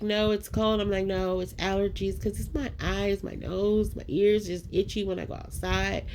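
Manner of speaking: normal talking voice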